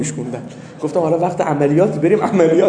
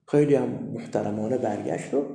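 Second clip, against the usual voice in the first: ordinary delivery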